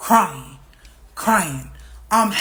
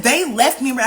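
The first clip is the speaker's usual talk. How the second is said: loud, higher-pitched complaint; retold angry outburst; 5 semitones higher, 5 dB louder than usual. Caller repeating her story to her friend, retold angry outburst. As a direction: hammer the grievance home. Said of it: "I'm going the fuck off".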